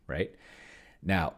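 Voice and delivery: normal talking voice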